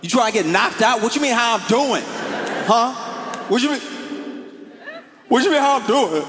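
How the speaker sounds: climbing from a sharp retort to a shrieking high-pitched rant; indignant yelling. Retold or imitated. Buddy snapping at a friend who asked how he's doing, escalating yell. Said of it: "His friend's like".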